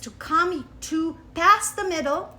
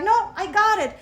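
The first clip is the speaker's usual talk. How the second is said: eager call; 7 semitones higher, 7 dB louder than usual. Retold or imitated. Eagerly greeting neighbour with dog.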